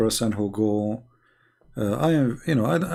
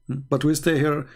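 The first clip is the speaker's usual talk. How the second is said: normal talking voice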